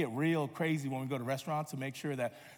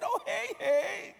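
very high, drawn-out child's wail, hammed-up; heartbroken little girl; much slower than usual. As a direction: imitate a child mourning her favourite. Daughter realises she eats favourite character.

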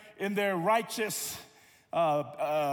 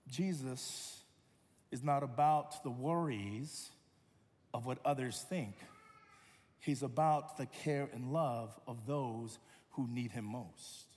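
tender, soft; 8 semitones lower, 6 dB quieter than usual. Real, not acted. Tender climax.

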